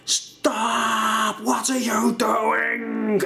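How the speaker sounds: high, slow cry of alarm from outside; mock call to stop the beating; high, drawn-out retold shout to stop. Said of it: "a voice go".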